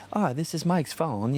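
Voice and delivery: mock British voicemail. London girl recording his voicemail, mock British accent. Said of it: "talk, you know, British or whatever".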